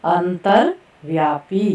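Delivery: अंतर्व्यापी spelled out slowly, each cluster clear; slow careful pronunciation. Shown as a demonstration. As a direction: drag out अंतर्व्यापी for the class. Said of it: "ध्यान से देखेंगे और सुनेंगे कि किस तरह से".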